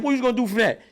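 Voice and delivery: challenging. Retold or imitated. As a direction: taunt the kid. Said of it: "he said".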